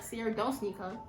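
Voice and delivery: a hushed private warning to self; quiet inner voice. Retold or imitated. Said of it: "But I'm like".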